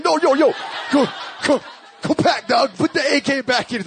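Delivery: high, urgent, loud orders; alarmed shouting. Retold or imitated. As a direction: reenact a panicked call to back off. Alarmed pastor orders gun away.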